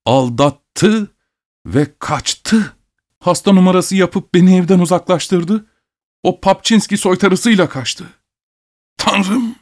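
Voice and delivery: high, climbing cry of betrayal; anguished cry. A narrator's reading. Betrayed husband tells the doctor his wife ran off, crying out. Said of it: "diye bağırdı".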